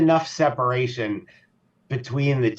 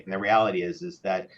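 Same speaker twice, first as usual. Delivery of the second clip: ordinary talk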